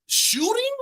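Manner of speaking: squeaky, slow, innocent pitch; high innocent voice. Retold or imitated. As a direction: play dumb in a high squeak. Playing dumb to police.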